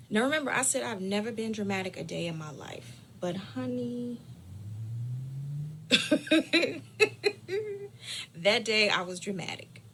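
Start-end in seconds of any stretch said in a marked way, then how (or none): 4.2-8.3 drawn-out laughing wail; long low laugh-cry. Real, not acted. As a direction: laugh till it almost cries. Laughing at own drama.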